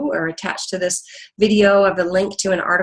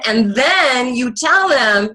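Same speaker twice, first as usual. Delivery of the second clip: slow, louder, higher, losing patience; firm escalation; 6 semitones higher, 5 dB louder, much slower than usual. Shown as a demonstration. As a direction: lean in hard, drawn out. Escalating to telling the child.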